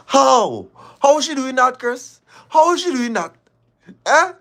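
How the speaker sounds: shrill disbelief. Real, not acted. Caller mocking Chris in loud, high-pitched disbelief. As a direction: cry out in disbelief.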